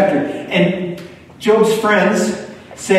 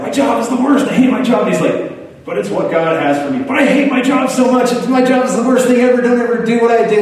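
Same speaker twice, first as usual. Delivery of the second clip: higher, whining imitation of a griping friend; whiny complaining friend; 6 semitones higher, faster than usual. Preacher mimics his friend complaining about work, whiny.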